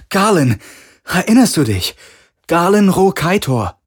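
high, desperate shouting; loud call. A narrator's reading. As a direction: shout to a fading voice to be recognized. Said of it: "rief er laut. Sein ganzer Körper bebte". (laut und hoch gerufen, drängend)

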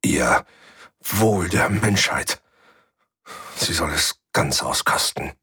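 leise, langsam, voller Hass; cold hatred. A narrator's reading. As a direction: die Verachtung auskosten lassen. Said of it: "Ich hörte den Hass". (kalt und hasserfüllt, tiefer)

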